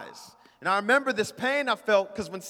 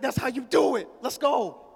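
proud father cheering; 6 semitones higher than usual. Retold or imitated. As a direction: imitate dad's high, excited approval. Father praising son after fight.

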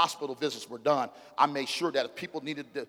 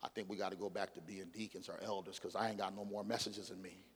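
tired confession to wife; 7 semitones lower, 17 dB quieter than usual. Retold or imitated. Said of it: "I looked at my wife and I said".

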